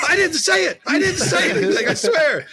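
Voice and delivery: high, pleading protest; hammed-up frightened excuse; mock panicked denial. Pleading innocence to angry player.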